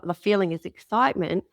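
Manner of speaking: ordinary talk, nothing marked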